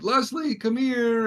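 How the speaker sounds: high, drawn-out uneasy call; alarmed calling. Retold or imitated. Aunt calls mom to the garage, alarmed calling. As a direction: imitate an aunt urgently summoning someone.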